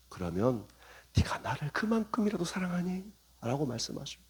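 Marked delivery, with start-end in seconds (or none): 0.1-3.1 gentle question. Acted. 주님의 다정한 질문.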